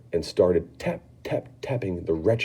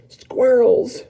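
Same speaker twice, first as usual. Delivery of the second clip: put-on high, loud spoilt-girl shout; a greedy girl yelling at the sight; Veruca shouts; 7 dB louder than usual. Veruca shouting at the squirrels, high and loud. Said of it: "shouted Veruca Salt".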